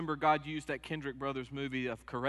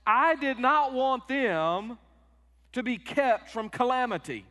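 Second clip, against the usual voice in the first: slow, loud, much higher sulking protest; indignant Jonah; 10 semitones higher, 8 dB louder, slower than usual. Retold or imitated. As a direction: drag out the grudge. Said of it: "That's the Lewis translation".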